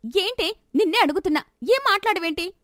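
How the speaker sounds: angry. Acted. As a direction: she snaps at the silent girl. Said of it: "Leelavathi కోపంగా".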